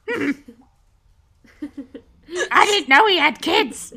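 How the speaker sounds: doubtful guard holding back a laugh; a touch of suspicious guard; puzzled goblin guard. Acted. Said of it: "looks at you and says".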